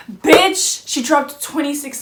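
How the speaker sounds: bursting out with the news; excited exclamation. Real, not acted. Sharing her friend's weight loss with viewers, excited and loud.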